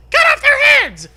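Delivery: very high, loud screaming order; coach yells. Acted. Coach Curley ordering the team, screaming yell. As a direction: scream the command at full tilt. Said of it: "Coach yells".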